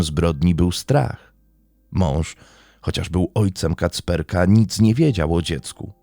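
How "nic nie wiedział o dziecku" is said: ordinary delivery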